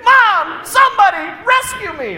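high, loud desperate shriek; screaming girl. Retold or imitated. Sister pleading with Mom to be rescued, high and loud. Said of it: "She screamed".

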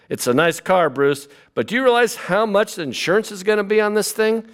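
lightly voiced mother fussing over the insurance; worried mother. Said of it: "How about Batman's mother?".

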